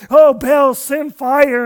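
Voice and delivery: loud pleading cry to a god; crying out to Baal. Retold or imitated. Prophets begging Baal for fire, loud cry. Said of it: "crying and are praying out".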